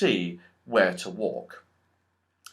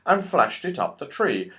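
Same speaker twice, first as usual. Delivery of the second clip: ordinary delivery, nothing marked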